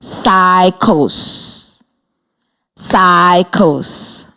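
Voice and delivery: each word said in two clear beats; slow teacher demo of Cycles in two beats; clapped syllables. Shown as a demonstration. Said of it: "Listen first".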